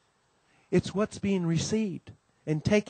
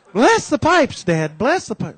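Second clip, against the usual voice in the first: high, loud insisting wife; mom insisting; 6 semitones higher, 6 dB louder, slower than usual. Retold or imitated. Wife repeating her demand to husband, high and loud. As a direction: press him again to bless them. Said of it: "Mom says".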